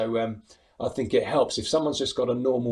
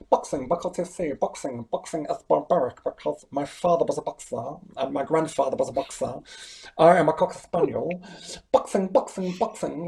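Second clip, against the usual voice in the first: high, lisping boxer impression; pompous boxer, pitched up with a lisp; Chris Eubank; 4 semitones higher than usual. Impressionist auditioning for pantomime, high lisping boxer voice. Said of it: "I'll do my Chris Eubank".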